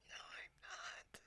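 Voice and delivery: slow, voiceless whisper; breath only, the voice gone; whispered voice break. Real, not acted. Voice cuts out after a laugh.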